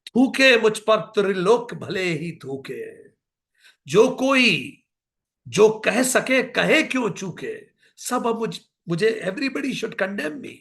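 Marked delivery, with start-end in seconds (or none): 0.1-9.2 slow, impassioned declamation; stately Hindi verse reading; poetic recitation. Acted. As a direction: declaim each line with weight. Recites Saket poem.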